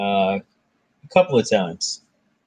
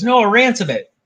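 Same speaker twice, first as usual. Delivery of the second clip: higher-pitched mock protest; Loki; 10 semitones higher, 4 dB louder than usual. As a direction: voice a hasty bargainer, pitched up. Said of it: "And Loki says".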